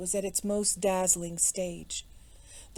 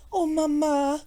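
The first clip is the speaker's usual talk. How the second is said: high, loud plea; pleading; 7 semitones higher, 5 dB louder than usual. A narrator's reading. Daughter begs her mother to stay, pleading. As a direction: implore her in a higher, girlish voice. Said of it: "pleaded Suzanne".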